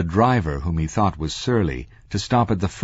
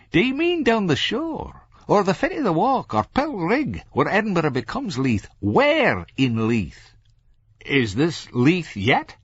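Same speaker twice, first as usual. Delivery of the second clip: high-pitched Scots cabbie firing options; surly driver quizzing; 13 semitones higher than usual. A narrator's reading. Driver asks Franklin where in Leith, pointedly. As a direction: quiz the passenger in a raised Scots voice.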